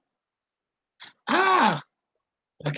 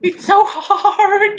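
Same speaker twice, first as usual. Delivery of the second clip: laughing; 13 semitones higher, 3 dB louder, slower than usual. Real, not acted. Laughing over in-character struggle.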